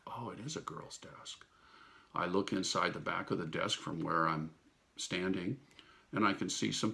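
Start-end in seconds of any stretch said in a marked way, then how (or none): 0.1-1.4 breathless hushed whisper; whispered thought. Retold or imitated. Noticing the girl's desk, hushed whisper. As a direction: whisper it to yourself.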